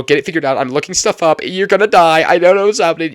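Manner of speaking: loud, high, frantic mother's rant; panicked mom. Retold or imitated. Mother on the phone warning her daughter she'll die, frantic yell. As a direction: rattle it off like a mom in full alarm. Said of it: "But she's freaking out".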